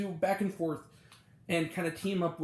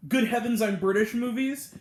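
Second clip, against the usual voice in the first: hammed-up posh British exclamation; a jokey toff gasp, louder; mock British outburst; 5 semitones higher, 6 dB louder than usual. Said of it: "that was a joke".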